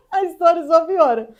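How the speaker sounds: high, loud words through laughter; amused, laughter spilling into the words; laughing. Real, not acted. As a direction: break up laughing at your own story. Laughs at her own story.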